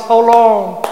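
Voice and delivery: the psalmist's loud cry of how long; pleading cry. Acted. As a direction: plead with God, desperate. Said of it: "One of the cry of the psalmist".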